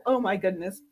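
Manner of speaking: normal talking voice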